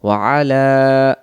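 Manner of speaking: ওয়া আলা with আলা drawn out; long la. Shown as a demonstration. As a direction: stretch the লা in আলা. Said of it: "লাম অক্ষর টান". (আলা টেনে পড়া)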